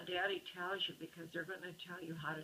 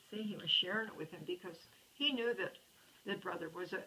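ordinary delivery, nothing marked

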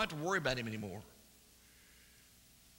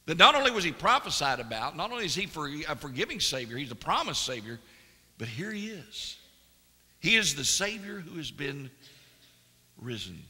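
loud, high, rising preacher's cry; full-force preaching; 5 semitones higher, 8 dB louder than usual. Acted. Preacher proclaiming the promised Savior to the congregation, loud. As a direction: preach it out, climbing with each phrase.

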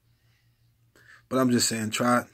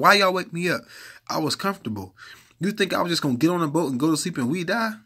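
higher, louder, fast indignant voice of Jesus; Jesus woken up; 8 semitones higher, 6 dB louder than usual. Acted. Preacher voicing Jesus woken by disciples, indignant.

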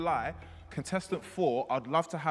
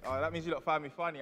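usual speaking voice

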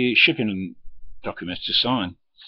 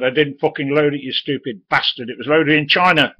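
loud, higher furious swearing; retold angry shout; 6 semitones higher, 8 dB louder than usual. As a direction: yell at the stupid lad. Driver swearing at the youth.

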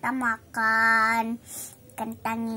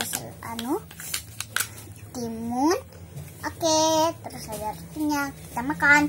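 ordinary delivery

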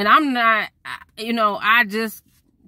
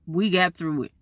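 normal talking voice

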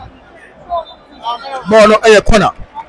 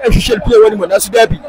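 usual speaking voice

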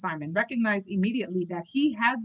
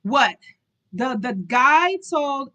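loud, slow shocked outburst; 4 semitones higher, 7 dB louder, slower than usual. Real, not acted. Reader stunned by the butler's news, shocked. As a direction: react in disbelief.

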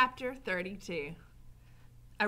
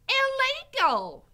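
high, loud, drawn-out shout; Mig shouting back; 11 dB louder, slower than usual. A narrator's reading. Mig answers Gregory about soup, high shout. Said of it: "shouted Mig back".

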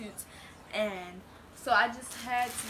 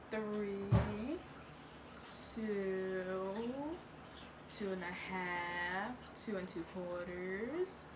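slow, stalling countdown, trailing softer; drawn-out countdown; 6 dB quieter, much slower than usual. Pretend. Gives viewers three seconds to answer, drawn-out countdown. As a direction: stretch each number out long.

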